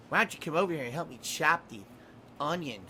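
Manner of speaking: soft, lisping seductive stepmom. Acted. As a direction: purr it like a seductive stepmom with a lisp. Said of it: "Why did he give her a lisp?".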